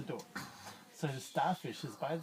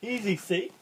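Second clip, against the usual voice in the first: fish showing off; 4 semitones higher, 8 dB louder than usual. Acted. Fish shows push-ups.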